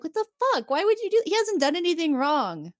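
high, indignant disbelief; outraged and high-pitched; shocked outrage. Retold or imitated. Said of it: "Geordi's like".

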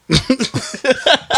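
sudden high burst of laughter; a quick squealing laugh. Real, not acted. Laughing at own joke.

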